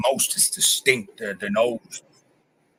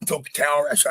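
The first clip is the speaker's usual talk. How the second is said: ordinary talk, nothing marked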